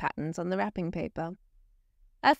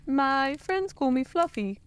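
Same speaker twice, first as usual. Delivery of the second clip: bashful bear; 5 semitones higher than usual. A narrator's reading. Bear telling Santa his name, bashful high voice. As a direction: say it sweetly, a bit embarrassed. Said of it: "replied the bear".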